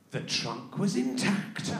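ordinary talk

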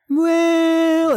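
very high, drawn-out mock hedge; evasive hedging. Captain dodges the virus question, very high and drawn-out. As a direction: stretch it out high, avoiding the answer.

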